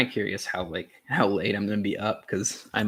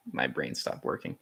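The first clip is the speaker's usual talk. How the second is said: whispered aside; 8 semitones lower, 8 dB quieter than usual. Real, not acted. Trails off after losing word.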